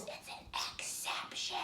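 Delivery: breathy whispered aside. Shown as a demonstration. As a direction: whisper it like a secret. Teacher whispers rule word.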